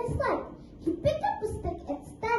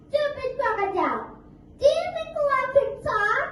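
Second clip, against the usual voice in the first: louder, high mocking jeer; taunting shout; 6 semitones higher, 6 dB louder than usual. Mouse Deer jeering at the crocodile, loud. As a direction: rub the crocodile's nose in it.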